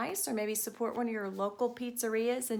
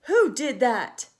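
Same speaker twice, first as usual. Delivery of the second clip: much louder, slow furious yell; angry shout; 11 dB louder, slower than usual. Acted. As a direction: bellow it at the room. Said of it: "he shouted".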